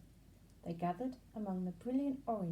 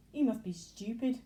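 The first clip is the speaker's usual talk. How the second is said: 1 jeering grasshopper; 3 semitones higher, 7 dB louder than usual. A narrator's reading.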